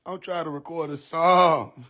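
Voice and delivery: ordinary talk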